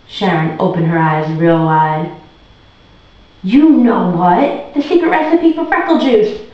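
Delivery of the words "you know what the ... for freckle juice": insistent. Acted. Andrew pressing Sharon.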